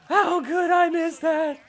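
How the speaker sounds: high-pitched, drawn-out wail; mock dismay. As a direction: lament a blown shot in a high voice. Acted player misses a shot.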